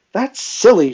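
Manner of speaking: sharp, loud, high retort; snapping. A narrator's reading. Mistress on edge at her maid's meekness, snapping. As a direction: snap it out, patience gone. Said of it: "she snapped".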